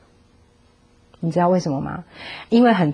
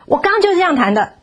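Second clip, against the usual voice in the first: higher, insistent, 就 pressed hard; stubborn child; 10 semitones higher than usual. Retold or imitated. Child insisting to mother he played it right, stubborn. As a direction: dig in like a sulky kid.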